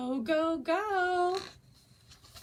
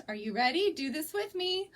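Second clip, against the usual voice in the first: ordinary delivery, nothing marked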